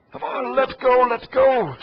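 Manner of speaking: loud strained grunting effort; forcing it with all his strength; straining hard. Real, not acted. Struggling to pry clips loose.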